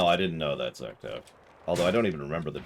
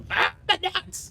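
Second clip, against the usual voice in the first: mock pained yelp; much slower than usual.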